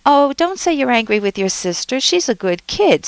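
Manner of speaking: lightly voiced parent denying feelings; mock-scolding parent; dismissive parent. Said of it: "Sometimes a parent might say".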